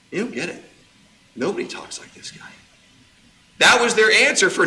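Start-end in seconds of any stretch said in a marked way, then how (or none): 0.1-2.5 whispered in hushed awe; awed whisper. Acted. Officers whisper their awe to the priests. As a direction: breathe it like a stunned guard.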